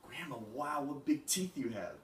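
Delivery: put-on childlike amazement. Girl marvels at disguised wolf.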